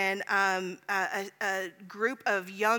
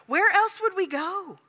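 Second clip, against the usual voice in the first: higher, slow, pleading Peter; 9 semitones higher, 7 dB louder than usual. Acted. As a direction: ask it as a desperate disciple. Peter's answer, voiced.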